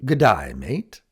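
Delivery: Australian G'day, mate, rising on mate; an Australian friend saying hi, in an Australian accent. Shown as a demonstration. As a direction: greet like a friendly Aussie.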